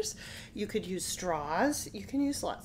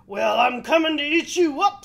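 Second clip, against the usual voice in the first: loud, higher troll threat; menacing troll; 6 semitones higher, 13 dB louder than usual. Acted. Troll threatens to eat the middle goat, loud. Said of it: "said the troll".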